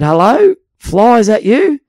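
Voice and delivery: star-struck hello down the phone; awed greeting. Retold or imitated. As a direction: fan greeting his hero coach on the phone. Nervously greeting famous coach.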